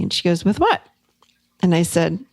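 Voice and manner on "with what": high, baffled question; retold puzzlement. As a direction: ask as if you have no idea, pitched up. Friend has forgotten the problem.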